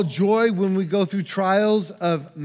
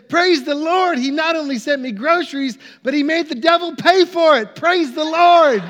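high, loud, building exultation; jubilant shouting; 9 semitones higher, 6 dB louder than usual. Retold or imitated. Lady clapping and jumping over her groceries, jubilant shouting. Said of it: "started jumping up and down and clapping her hands".

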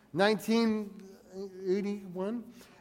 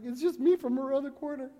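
put-on higher, softer, small boy; pleading kid; 6 semitones higher, 4 dB quieter than usual. Boy explaining himself to the grumpy neighbor, higher and softer. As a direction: plead like an innocent ten-year-old.

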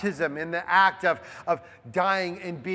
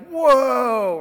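loud, high mock "Whoa"; hammed-up surprise; mock startled; 5 semitones higher, 7 dB louder than usual. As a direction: pretend to be taken aback. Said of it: "not even like".

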